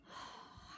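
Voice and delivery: long weary sigh; tired sigh. Acted. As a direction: sigh out exhausted. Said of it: "sighed a tired Missus Ladybug".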